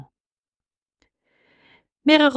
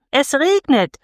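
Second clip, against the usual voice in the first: higher, gleeful call on regnet; children calling; 7 semitones higher, 3 dB louder, slower than usual. A narrator's reading. Children running in the rain call it out, excited. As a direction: cry out the news like a delighted child. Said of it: "riefen die Kinder".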